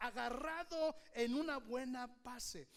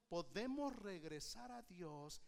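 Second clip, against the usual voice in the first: ordinary talk, nothing marked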